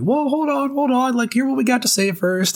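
high-pitched, hurried scout cutting in; imitating a scout who won't take no for an answer; eager scout. Scout cutting off the player who just turned him down, high-pitched and pleading.

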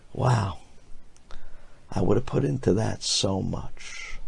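usual speaking voice

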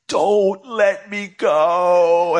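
high, slow, far-off hammed-up plea; mock pleading stranger. Daydreamed stranger begs the speaker, high hammed-up plea. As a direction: overplay a desperate passenger begging.

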